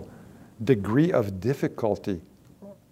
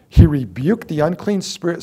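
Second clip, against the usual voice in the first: louder, rising rebuke; forceful preaching; 4 semitones higher, 7 dB louder than usual. Real, not acted. Preacher telling how Jesus rebuked the unclean spirit, forceful.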